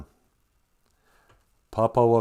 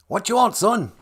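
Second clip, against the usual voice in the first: higher, louder mock-God reply; booming father answer; 10 semitones higher, 5 dB louder than usual. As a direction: answer loudly like a put-upon father.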